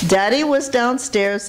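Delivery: ordinary talk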